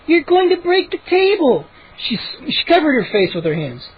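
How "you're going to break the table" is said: high alarmed scolding at normal volume; mild narrated scream. A narrator's reading. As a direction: warn in a high, upset voice. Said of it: "Mindy screamed".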